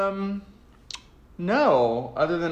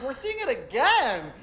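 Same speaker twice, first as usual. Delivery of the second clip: mock eager fan; 10 semitones higher than usual. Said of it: "He's like".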